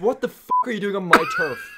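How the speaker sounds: high-pitched mock outrage; mock confrontation. Co-host plays actor confronting paparazzi, mock outrage. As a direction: playfully challenge an intruder.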